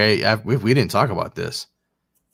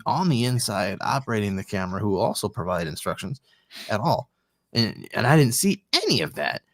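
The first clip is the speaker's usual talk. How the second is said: usual speaking voice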